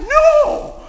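put-on girl begging the neighbor not to burn her finger, screaming; frantic scream. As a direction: scream it out in panic. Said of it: "And she's like".

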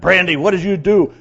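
loud, high scolding; angry yell. Retold or imitated. Owner confronts dog that ate his bulbs, angry yell. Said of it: "I yelled at her".

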